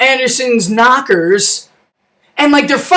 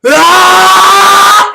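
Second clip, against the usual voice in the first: long, high, loud mock yell; sustained full scream, rising slightly; final scream; 7 semitones higher, 6 dB louder than usual. Burning Chelios yelling at the camera, long loud scream.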